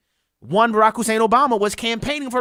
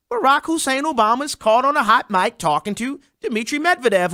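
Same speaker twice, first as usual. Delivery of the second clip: ordinary talk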